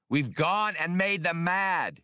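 hollering. A narrator's reading. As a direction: call it out over the engine noise. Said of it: "he hollered".

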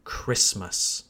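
"Christmas" as KRISS-məs, low and soft; silent T. Shown as a demonstration. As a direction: leave out the T in "Christmas". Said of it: "we don't pronounce the T".

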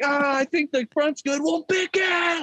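timid customer. Retold or imitated. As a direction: answer meekly, scared of the clerk.

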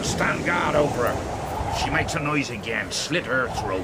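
cold menace. Acted. Leader orders coldly.

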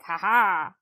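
mock triumphant laugh. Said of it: "this guy was like".

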